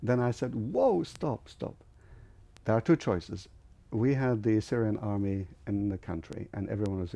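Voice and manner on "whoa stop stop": high, drawn "whoa" to halt them; retold interruption. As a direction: cut the scolding short. Cuts off the scolding crowd.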